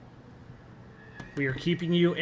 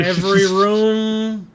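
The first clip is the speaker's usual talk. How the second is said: slow, loud and laughing; dramatic narration; 4 semitones higher, 7 dB louder, slower than usual. Acted. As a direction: lean into the dread. GM dramatic description.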